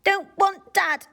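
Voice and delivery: put-on loud, slow, high toddler bellow. A narrator's reading. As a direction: bellow it stubbornly. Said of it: "bellowed the baby".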